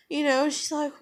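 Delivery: normal talking voice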